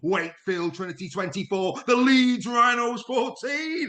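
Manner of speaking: big rising sports-highlights commentary, a few shouts; excitable commentary. Acted. Presenter calling the highlights reel with hammed-up excitement. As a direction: sell every score like the match of the year.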